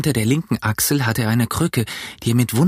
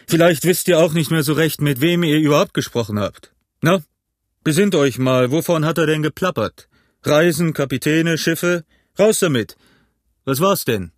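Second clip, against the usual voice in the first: higher, impatient interrogation; exasperated grilling; 4 semitones higher than usual. A narrator's reading. Innkeeper grills Morgan about the talk, impatient. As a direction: push and prod for the answer.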